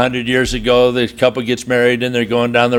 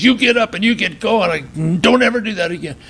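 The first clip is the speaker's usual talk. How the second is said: mock angry threat; 7 semitones higher than usual. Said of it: "whipping it and thinks".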